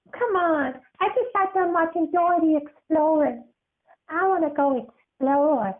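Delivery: put-on high excited kid voice; a bold child itching to explore; high, bouncy girlish voice; eager little girl.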